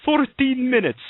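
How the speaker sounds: high, loud attempt at a British accent; bad British accent. Host mimicking the British lady's countdown, bad British accent. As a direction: announce it in a poor, high-pitched British accent. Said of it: "that's my British".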